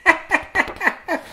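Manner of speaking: a high squealing giggle. Retold or imitated. Kids giggling when auntie trips, high squealy laugh.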